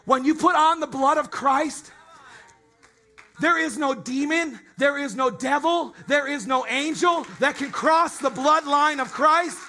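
loud, high, building preacher's cry; rising impassioned oratory. Acted. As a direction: preach it full force, climbing. Sermon builds to a shouted climax.

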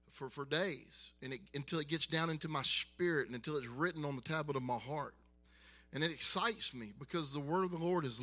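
loud, driving sermon build; preaching at full force. Acted. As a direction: preach it out with rising fire.

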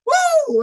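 high-pitched "woo"; exultant whoop. Real, not acted. Preacher overcome after the song lines, high exultant whoop. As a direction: let out a high church whoop.

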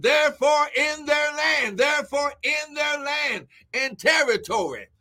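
impassioned preaching with praise whoops; exultant, forceful sermon cadence; fervent preaching. Real, not acted. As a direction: drive the promise home, chanting repeated phrases.

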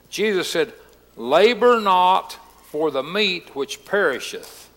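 slow, low, measured Bible reading; scripture reading. Real, not acted. Pastor reading Jesus' words to the congregation, scripture reading. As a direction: read the verses out deliberately, drawing out key words. Reads Bible passage aloud.

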